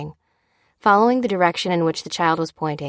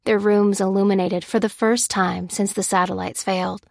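ordinary delivery, nothing marked

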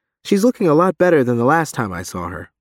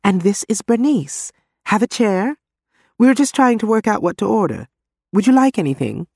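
eager hostess; 10 semitones higher than usual. Acted. Janice voiced brightly, higher.

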